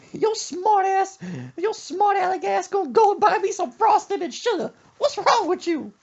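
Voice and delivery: very high, loud screeching scold; mock furious mama. Mother scolding smart-aleck son, screechy mock fury. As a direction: screech like a mother at her wits' end.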